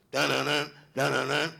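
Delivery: ordinary talk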